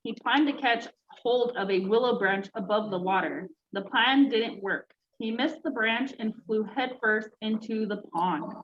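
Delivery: usual speaking voice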